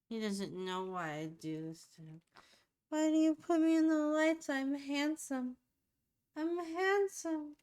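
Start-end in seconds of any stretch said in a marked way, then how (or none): 2.9-7.6 voicing the baby. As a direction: put words in the baby's mouth, high and loud.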